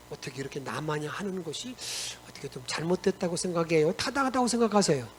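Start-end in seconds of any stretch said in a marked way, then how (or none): none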